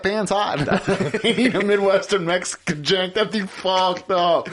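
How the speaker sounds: mock Wisconsin server warning of a hot pan; Wisconsin accent. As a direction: laugh through a hammed-up Wisconsin warning. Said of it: "Wisconsin accent".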